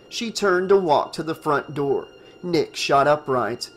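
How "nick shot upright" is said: ordinary delivery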